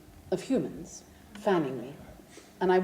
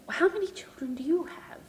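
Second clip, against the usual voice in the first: whisper; 8 semitones higher than usual. Retold or imitated. Polite question to the sheikh.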